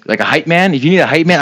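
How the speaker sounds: ordinary talk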